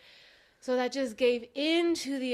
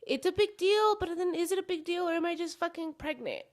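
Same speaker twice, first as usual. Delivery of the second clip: inner voice quote; 7 semitones higher than usual. Retold or imitated. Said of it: "So I'm like".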